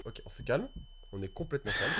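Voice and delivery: soft, low self-soothing; forced calm. Real, not acted. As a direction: talk yourself down quietly. Forcing calm.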